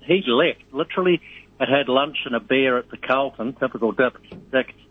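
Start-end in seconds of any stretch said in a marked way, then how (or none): none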